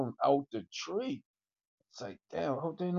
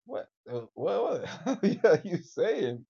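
ordinary talk

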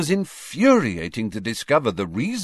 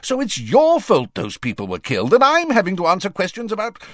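raised, high-pitched angry outburst; exasperated fury; furious; 12 semitones higher, 3 dB louder than usual. A narrator's reading. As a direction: fling the blame back furiously. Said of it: "said the Prime Minister furiously".

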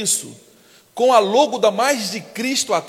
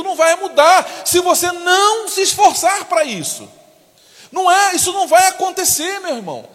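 higher and louder, shouting; full-force preacher's shout; preaching shout; 7 semitones higher, 3 dB louder than usual. Real, not acted. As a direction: hammer it home to the congregation.

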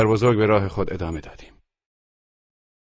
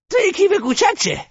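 put-on high defiant old woman; reckless grandmother; 4 dB louder than usual. A narrator's reading. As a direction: throw caution away. Grandmother asks about purse.

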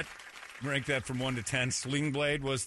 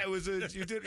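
ordinary talk, nothing marked

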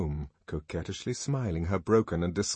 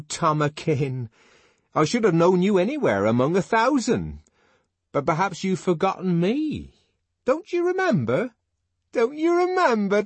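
Linda pleading recognition; 12 semitones higher, 7 dB louder than usual. Acted. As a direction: implore an old lover to remember. Linda pleads with former lover.

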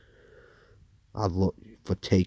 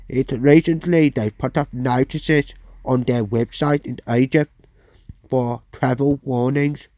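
higher, louder bad London accent; London accent attempt; 6 semitones higher, 6 dB louder than usual. Presenter voicing a freed prisoner's quote, attempted London accent. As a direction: have a go at a London accent and miss. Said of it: "I can't do a London accent".

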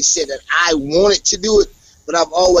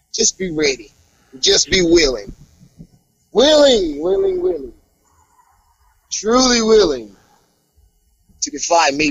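wrestling promo taunt; 5 semitones higher than usual. Acted. Wrestler taunting Myron Reed before their match, promo voice.

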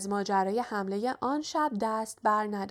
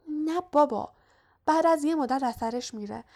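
higher, dismissive brush-off; impatient; 6 semitones higher, 4 dB louder than usual. A narrator's reading. Hermione dismisses Ron's worry about the potion, impatiently. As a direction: wave the worry away impatiently.

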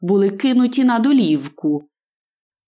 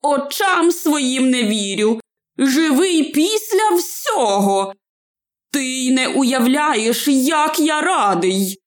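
raised, wondering exclamations; higher pitch, drawing out the joyful words; overjoyed; 6 semitones higher than usual. A narrator's reading. Wizard greets hobbit alive after battle, overjoyed. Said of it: "чарівник страшенно зрадів".

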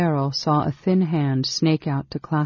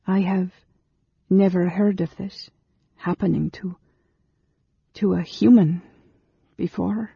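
lightly higher, kind woman's voice; gentle young woman; 4 semitones higher than usual. A narrator's reading. Amberol's voice.

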